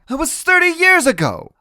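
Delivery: very high, loud grandmother; high-pitched, shouted retort; heated retort. A narrator's reading. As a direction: fire back at him. Grandmother argues back.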